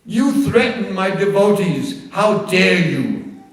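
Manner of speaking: slow, loud, menacing growl; growling angry. Acted. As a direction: roar it out like a lion god. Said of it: "he growled at me".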